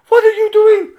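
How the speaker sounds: very high, loud shriek; imitated outraged yell. Swiss climber to his rescuer, high outraged yell. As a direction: mimic a climber shrieking in protest.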